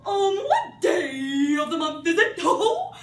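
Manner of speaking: loud, high, slow manic question; mad Hatter. Acted. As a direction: bellow it, laughing madly.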